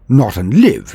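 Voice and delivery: ordinary delivery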